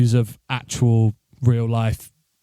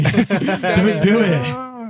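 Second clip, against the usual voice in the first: very slow, laughing plea; mock plea, laughing; 6 semitones higher, much slower than usual. Real, not acted. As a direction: beg him through laughter.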